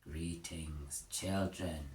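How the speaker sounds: low, soft, slow cracked machine voice; a hint of a creepy gramophone voice; eerie monotone. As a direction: intone each word haltingly. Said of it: "spoke in an eerie, cracked monotone".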